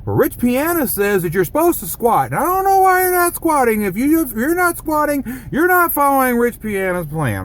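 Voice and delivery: very high cartoon know-it-all voice; Brainy Smurf. Shown as a demonstration. Mocking a commenter who scolds about squats, cartoon know-it-all. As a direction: lecture like a squeaky cartoon pedant. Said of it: "let me do it in my Brainy Smurf voice".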